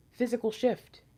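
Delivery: usual speaking voice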